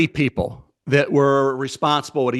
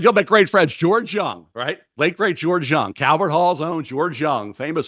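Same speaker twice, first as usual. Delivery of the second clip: ordinary talk, nothing marked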